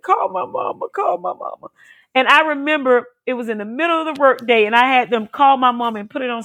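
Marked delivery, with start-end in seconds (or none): 0.0-1.7 high, softer tearful begging; crying plea. Retold or imitated. Patient recalling her call for mama, crying plea. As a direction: plead tearfully for help, pitched way up. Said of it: "I burst out crying".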